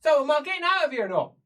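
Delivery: high, loud demand; exasperated. Acted. Prisoner wanting out of the cell, exasperated and loud. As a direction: cut in sharply and demand an answer.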